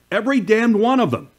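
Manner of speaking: high, punchy emphasis; emphatic outburst. Real, not acted. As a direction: pitch jumps high on every damned one. Indignant emphasis in commentary.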